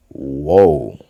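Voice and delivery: normal talking voice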